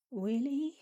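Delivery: put-on high, wondering little-girl voice; high baby voice, hopeful; inner child baby voice. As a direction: ask it like a small child hoping it's true. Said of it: "that's how my inner child sounds".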